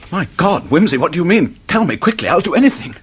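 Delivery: high, urgent, begging to be told; clutching at the last hope, pitched high; desperate pleading. Acted. A doomed man begs Wimsey to tell him the way out, high and urgent.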